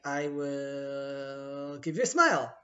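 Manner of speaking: drawn-out playful bribe, slow; stretched-out teasing bargain; slow coaxing offer. Retold or imitated. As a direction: coax him slowly with a smile. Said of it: "I'm like".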